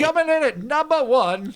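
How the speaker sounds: announcer voice. Acted. Announcing like an emcee.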